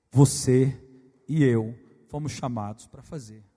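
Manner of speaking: booming, drawn-out call from the pulpit; preaching at full force. Acted. Pastor rousing the church with a loud, urgent call. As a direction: declare it to the congregation, loud.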